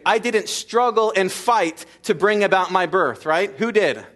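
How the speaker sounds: impassioned sermon build; preaching at full force. Acted. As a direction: hammer it home from the pulpit.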